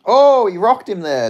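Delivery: loud, high burst at the knockdown; excited shout. Real, not acted. Viewer reacting to a big punch, excited shout. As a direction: yell it out as the punch lands.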